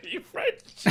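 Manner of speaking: squealing high laugh. Real, not acted. Laughing at the mangled accent.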